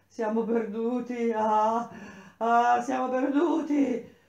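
high, loud wail of doom; loud cry. A narrator's reading. Seer in a fit of fear warns all, crying out loud. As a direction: cry out in despair, voice pitched right up. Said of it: "gridai ad alta voce".

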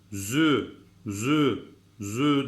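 "zü" as a sharp buzzing z with rounded ü; zü sound. Shown as a demonstration. Teacher showing sharp "zü" to students. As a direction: model the sharp "zü". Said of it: "Z harfimizi de".

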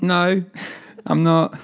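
high, loud retold refusal, broken by laughter; blunt refusal. Pupil refusing the French teacher's class, amused blunt refusal. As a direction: refuse cheekily, pitched well up.

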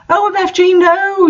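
shrill high panicked shout; hammed-up cry of alarm. A narrator's reading. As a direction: scream it way up high. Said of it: "shouted Lucan".

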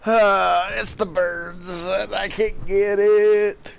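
slow, drawn-out, high exasperation; exasperated drawl. Real, not acted. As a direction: stretch it out, fed up with the birds. Frustrated at birds not landing.

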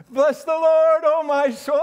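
high, loud ringing praise; exultant praise. Real, not acted. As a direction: proclaim it with joy.

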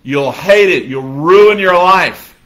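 loud, slow doom-laden warning; bitter stranger in the elevator warning the bride; ominous warning. Retold or imitated. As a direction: warn her off like a prophet of doom. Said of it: "the lady looks at her and says".

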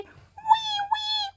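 crying toy panda. Pretend. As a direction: pretend baby-panda wail, very high.

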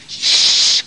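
sharp unvoiced shh; shushing. Hiding from the robbers, a sharp shushing. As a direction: hush him quickly. Hushing behind the stove.

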